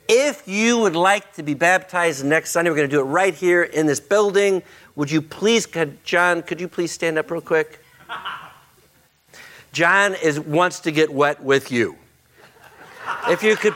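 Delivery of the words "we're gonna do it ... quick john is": ordinary delivery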